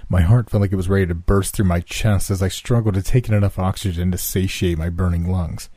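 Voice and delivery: plain reading voice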